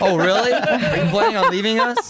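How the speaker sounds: laughing tease. Real, not acted. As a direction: tease her through real laughter. Teasing wife, laughing.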